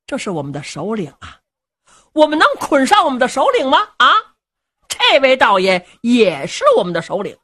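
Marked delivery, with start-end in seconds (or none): none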